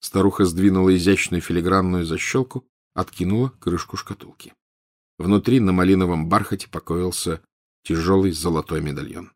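ordinary delivery

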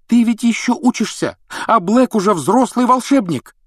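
high, loud exclamation; exclaiming. Acted. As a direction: blurt it out loudly. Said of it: "воскликнула Гермиона".

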